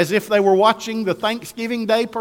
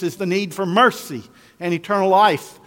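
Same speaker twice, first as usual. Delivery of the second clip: ordinary talk, nothing marked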